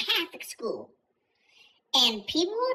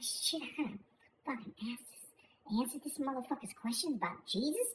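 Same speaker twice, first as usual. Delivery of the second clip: low, much softer grumble under the breath; annoyed aside, barely voiced; muttered inner thought; 5 semitones lower, 13 dB quieter than usual. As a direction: grumble it to yourself so nobody hears. Uncle's inner thought retold.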